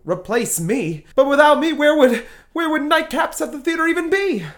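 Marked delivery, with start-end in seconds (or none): 1.2-4.5 higher and louder, rattled protest; indignant panic. Acted. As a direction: stammer it out, clutching at straws. Panicking over losing his show.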